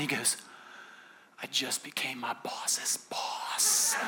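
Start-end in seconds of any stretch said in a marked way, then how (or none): 1.4-4.0 very slow, hushed whispered reveal; drawn-out imitated whisper of amazement; stunned whisper. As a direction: whisper it as if hardly believing it. Imitating friend's stunned big reveal.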